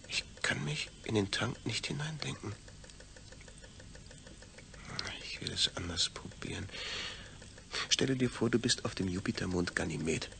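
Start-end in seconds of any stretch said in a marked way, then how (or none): 0.1-6.7 soft unvoiced whisper; hushed, quietly stubborn. Man whispering to himself as he tries again. Inner monologue, failing to imagine.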